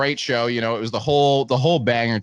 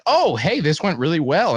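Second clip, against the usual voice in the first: high, bright imitation of a pleased person; mock cheerful remark; 6 semitones higher than usual. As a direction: chirp it like someone delighted it went well. Retelling someone's upbeat remark.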